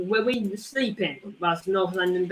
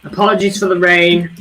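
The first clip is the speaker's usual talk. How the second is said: raised voice over the rain; louder to be heard over the rain; speaking louder; 9 dB louder than usual. Real, not acted. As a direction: push the voice out over rain noise. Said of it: "I'm gonna try to speak louder".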